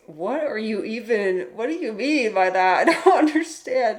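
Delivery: puzzled and cracking up mid-question; a laughing, confused reply to a weird stranger. Retold or imitated. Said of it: "I'm like".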